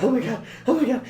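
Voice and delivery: high-pitched imitated panic; starting to freak out. Speaker retelling Peter's climb on the roller coaster, mock freak-out. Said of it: "starts, like, kind of freaking out".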